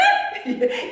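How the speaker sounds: short falling laugh. Real, not acted. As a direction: laugh at your own outburst. Laugh after retort. (짧게 터지는 웃음)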